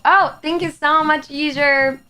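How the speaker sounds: excited thanks. Real, not acted. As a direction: gush a thank-you at the viewer.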